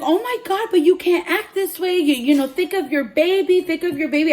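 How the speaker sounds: high, loud mock pleading; alarmed pleading. Hairdresser urges pregnant client to calm down, alarmed pleading. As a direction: imitate an agitated scolding plea.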